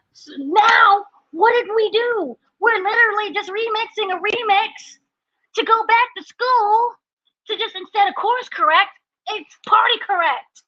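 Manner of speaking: frustrated outburst. Real, not acted. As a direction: vent real frustration at the writers. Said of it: "I am so frustrated".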